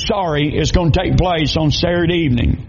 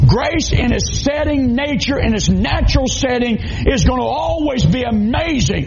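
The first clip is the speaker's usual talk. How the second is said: raised, ringing preacher's voice, much higher; fiery preaching; 6 semitones higher than usual. Acted. Preaching at full force.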